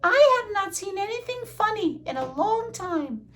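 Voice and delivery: very high little mouse voice; high-pitched storybook mouse; eager field mouse. Acted. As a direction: squeak it out with relish.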